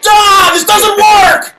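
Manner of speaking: high, slow, loud nerd outrage; indignant engineer. Retold or imitated. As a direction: shout it like a fed-up engineer. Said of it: "I should be watching that movie and being like".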